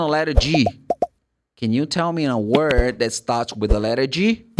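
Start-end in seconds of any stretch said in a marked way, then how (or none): none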